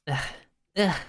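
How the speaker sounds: usual speaking voice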